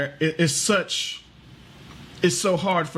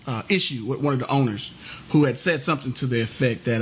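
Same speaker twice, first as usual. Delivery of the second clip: ordinary talk, nothing marked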